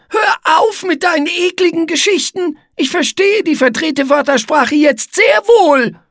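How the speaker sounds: very high, indignant spider voice; huffy squeaky figure taking offence; offended. A narrator's reading. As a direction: protest in a loud, hurt squeal. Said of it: "sagte Igitte gekränkt".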